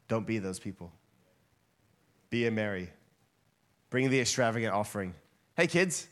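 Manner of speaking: usual speaking voice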